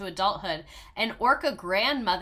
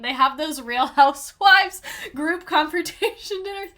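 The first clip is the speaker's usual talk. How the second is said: hyped-up gossip; 7 semitones higher, 9 dB louder than usual. Real, not acted. Gossipy Housewives comparison.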